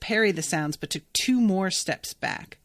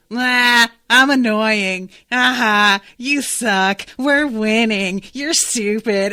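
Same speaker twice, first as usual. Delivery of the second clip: loud, smug cat gloating, slower on we're winning; Mogget gloating; 7 dB louder, slower than usual. Retold or imitated. Mogget the cat gloats at Clore, loud smug jeering. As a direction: jeer like a bratty hype man. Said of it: "Just be like".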